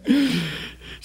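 long delighted laugh. Acted. Acting Jesus delighted by the woman, amazed laugh.

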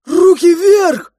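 loud, high, drawn-out command; forceful shouted order; soldiers' shout. A narrator's reading. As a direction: yell it out like a charging soldier. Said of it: "закричали подбежавшие к нам солдаты".

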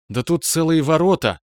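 sudden high astonished cry; surprised. Acted. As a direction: gasp it out in disbelief. Lena shocked by wide gap.

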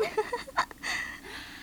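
airy giggle; a light surprised laugh; breathy laugh; breathy high laugh. Real, not acted.

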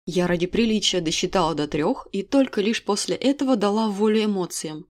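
ordinary delivery, nothing marked

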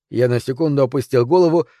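ordinary delivery, nothing marked

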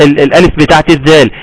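ordinary talk

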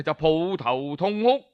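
plain reading voice

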